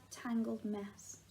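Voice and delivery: plain reading voice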